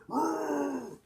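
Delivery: loud high mock roar; devil roar. Cousin dressed as the devil roaring at the church, hammed up.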